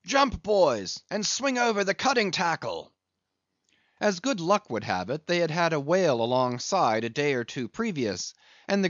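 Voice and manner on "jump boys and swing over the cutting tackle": loud, raised ship's command; a captain hailing his men; shouted order. Acted. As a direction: bellow it across the deck to the crew. Captain calling orders to crew.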